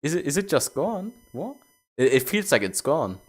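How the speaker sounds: real disbelief that it's gone; baffled surprise. Real, not acted. Chat message deleted, baffled.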